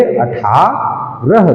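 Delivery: अठारह stretched out slowly, syllable by syllable; teacher drawls अठारह slowly so children catch each syllable. Shown as a demonstration. Slowly syllable by syllable, so the class hears अठारह. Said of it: "दो बार में उच्चारण होता है".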